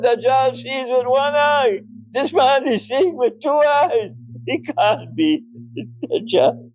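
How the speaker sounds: high mocking voice of the Salafi brothers; high mock lecturing tone; mocking quote. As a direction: lift the pitch to mock their argument. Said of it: "my Salafi brothers will say".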